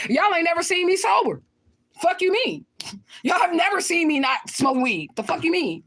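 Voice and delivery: high-pitched, incredulous retort; indignant. Real, not acted. Answering a viewer who says she looks high, indignant.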